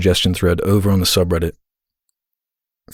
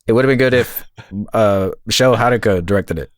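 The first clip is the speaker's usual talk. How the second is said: lightly voiced co-host impression with a laugh; half-hearted take on his friend's delivery. Asked by a listener, lightly mimics his co-host. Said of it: "Both of you do an impression of the other".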